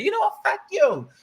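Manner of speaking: high-pitched mock outburst; exasperated, high retort; imitated frustration. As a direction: snap it like someone fed up mid-argument. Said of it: "she typically gets so frustrated and say".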